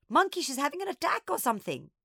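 high, urgent panic; gasped alarm. A narrator's reading. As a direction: blurt it to a friend in alarm. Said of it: "I gasped".

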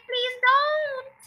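high, drawn-out plea; beg, letting the last word stretch; pleading prayer. A narrator's reading. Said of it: "he prayed".

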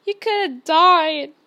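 slow, loud, wailing lament; sobbing. A narrator's reading. Hagrid to Harry, loudly wailing in remorse. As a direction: drag it out in tearful anguish. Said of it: "sobbed Hagrid".

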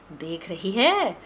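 slow, drawn-out coaxing; slow persuading show-off. Acted. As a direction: lay it out slowly to tempt her. Showing off saris to vendor.

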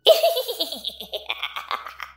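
high cackling witch laugh, fading down; witch's laugh. Acted. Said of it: "what sounded like a witch's laugh".

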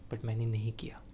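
breathy hushed whisper; soft whispered reassurance. Acted. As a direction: drop to a whisper. Varun reassures crying Riya.

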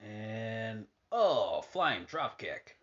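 casual ringside play-by-play, hushed between moves; wrestling commentator. Acted. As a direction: call each move as it lands. Calling the match on TV.

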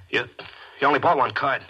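stunned, almost shouting; losing player cries out in disbelief; shocked disbelief. Acted. Shocked at losing to straight flush.

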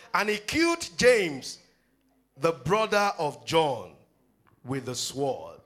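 slow, loud stressed reading; emphatic reading. Acted. Preacher reading the verse to the congregation, slow and emphatic. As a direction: weigh each word of the verse. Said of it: "emphatic reading".

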